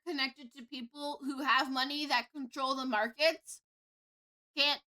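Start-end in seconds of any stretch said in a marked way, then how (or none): none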